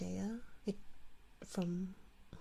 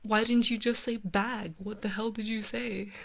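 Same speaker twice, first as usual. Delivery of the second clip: mock exasperated American; 2 semitones higher, 5 dB louder than usual. Clerk baffled by her Canadian bag, mock exasperated. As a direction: ham up a baffled store clerk. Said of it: "they're like".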